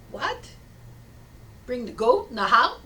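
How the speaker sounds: acted disbelief, loud swooping rise on "goat" and "house"; incredulous question. Villager questioning rabbi's odd advice, incredulous. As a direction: echo it back as a baffled question.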